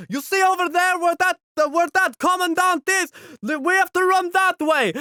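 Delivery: high, loud battlefield directions; urgent soldier. Retold or imitated. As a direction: point out the target over the noise. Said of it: "He's just like".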